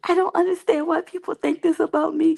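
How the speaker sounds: high-pitched mock whimper after a sigh; damsel in distress. Mimicking a contestant feeling misjudged, mock damsel in distress. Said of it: "you're giving damsel in distress. You're giving, like".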